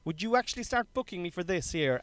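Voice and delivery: a hint of a keen young comic pitching himself; eager comic. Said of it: "you're like".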